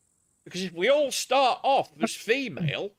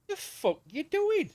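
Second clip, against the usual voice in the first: high, slow retold disbelief; shocked outburst; 10 semitones higher, slower than usual. Telling Dave how he reacted to the nipple sucking, aghast.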